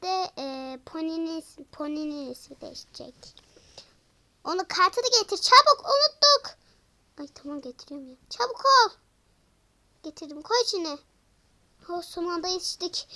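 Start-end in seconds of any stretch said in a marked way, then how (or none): 4.4-6.6 much higher, louder urgent cry; panicked hurry. Acted. Doll realizing the card was forgotten, crying out in a panic. 8.3-8.9 urgent. Acted. Urging hurry.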